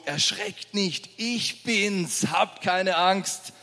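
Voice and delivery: gently calm the frightened disciples; calm Jesus. Acted.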